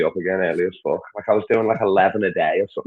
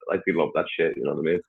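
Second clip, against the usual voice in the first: usual speaking voice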